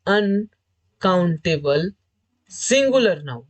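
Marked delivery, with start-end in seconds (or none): 0.1-1.9 slow, stretched word. Shown as a demonstration. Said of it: "ये noun है आपका uncountable".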